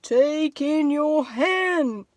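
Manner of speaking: slow, drawn-out sage voice; a very slow, measured wise old man. Acted. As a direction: speak slowly like a calm old sage. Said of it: "said the wise old man".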